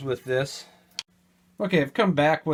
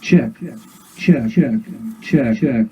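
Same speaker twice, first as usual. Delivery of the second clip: louder mic check, echoed back; mic check through the echo; 2 semitones lower, 5 dB louder, slower than usual. Shown as a demonstration. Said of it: "you can hear we're back in business".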